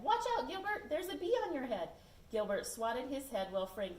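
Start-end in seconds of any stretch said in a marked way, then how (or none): 0.0-1.9 high, loud fake alarm; teasing warning. Frank fooling Gilbert, high and loud fake alarm. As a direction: sound the alarm to fool him. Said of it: "Frank said".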